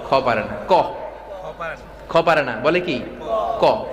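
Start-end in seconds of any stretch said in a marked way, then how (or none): none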